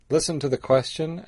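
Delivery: ordinary delivery, nothing marked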